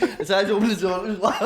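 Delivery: normal talking voice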